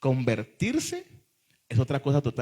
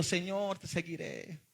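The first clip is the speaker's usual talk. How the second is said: high, soft mock-tearful cry to God; softly plead, as if through tears, in imitation; imitating tearful pleading; 3 semitones higher, 9 dB quieter than usual. Preacher mimicking a hundred young converts at a retreat, tearful pleading. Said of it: "Con lágrimas".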